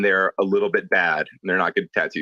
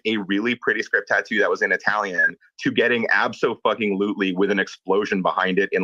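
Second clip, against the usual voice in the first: usual speaking voice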